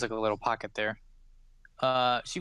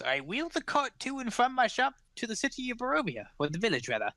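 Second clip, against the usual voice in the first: put-on starts high and old, settles down by the end; old woman explaining her cart, pitched up then easing; old pie seller; 10 semitones higher, faster than usual. Old woman explains her cart to the gnome, high old voice.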